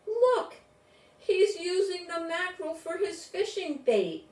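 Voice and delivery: high, loud astonished cry; astonished exclaim. A narrator's reading. Narrator as child, astonished at the seal's trick. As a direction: blurt it out in amazement. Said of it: "Astonished, I ex-, exclaimed".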